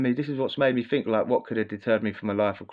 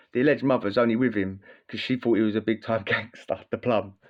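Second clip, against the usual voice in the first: usual speaking voice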